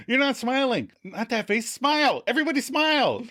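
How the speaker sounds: high, urgent mock panic; panicked. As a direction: hiss the order to smile in a rising panic. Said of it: "And then Joey panics".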